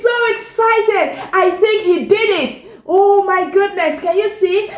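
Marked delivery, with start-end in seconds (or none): none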